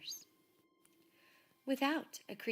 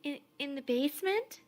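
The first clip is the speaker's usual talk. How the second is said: higher, louder halting question; stammering Laszlo; 7 semitones higher, 7 dB louder than usual. A narrator's reading. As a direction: stumble over it in fear. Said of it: "asked Laszlo".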